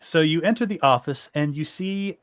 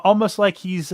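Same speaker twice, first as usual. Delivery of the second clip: usual speaking voice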